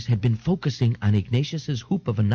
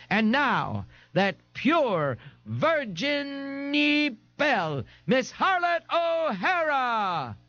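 much higher, slow, drawn-out stage announcement; show announcer; 12 semitones higher, much slower than usual. A narrator's reading. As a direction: stretch out the introduction like a cheap emcee. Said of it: "the Nazi proprietress was saying".

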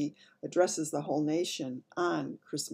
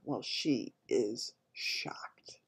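very slow, breath-soft remark; hushed aside; 5 semitones lower, 5 dB quieter, much slower than usual. A narrator's reading. Storyteller showing the maid's picture, hushed. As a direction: draw each word out in a hush.

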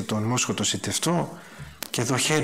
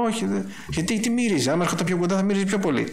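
agitated retelling; 7 semitones higher, faster than usual. Retold or imitated. Retelling his own refusal.

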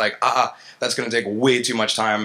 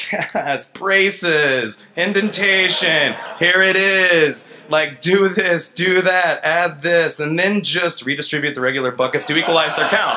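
much higher, louder and slow with a laugh; excited relief; 6 semitones higher, 4 dB louder, slower than usual. Retold or imitated. Engineer seeing pseudocode, overjoyed and laughing. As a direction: gush over finally finding code. Engineer thrilled to see code.

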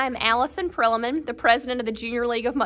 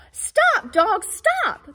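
calling out stop; 8 semitones higher, much slower than usual. Acted. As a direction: call out to halt the dogs. Calling out to the dogs.

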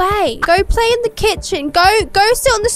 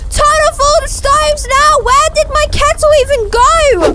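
panicking; 7 semitones higher than usual. Acted. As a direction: freak out at the mess. House flooding panic.

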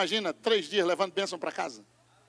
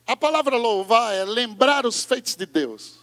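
normal talking voice

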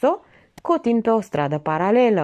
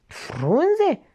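astonished one-word question, pitched up high; surprised; 9 semitones higher than usual. Acted. Lae marvelling at the leaves, astonished. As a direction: echo the word in disbelief. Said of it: "se minună Lae".

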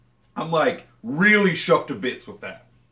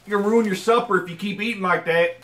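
higher, laughing mock scold in a Southern twang; Southern mom scolding; 6 semitones higher, 4 dB louder than usual. Mocking Southern parents, a laughing high mock scold. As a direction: play a nagging country mother.

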